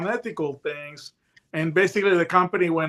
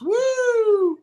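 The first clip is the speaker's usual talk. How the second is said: high, loud mock siren whoop; imitated siren whoop; 6 dB louder than usual. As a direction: call out woo like a police siren. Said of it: "and I hear this".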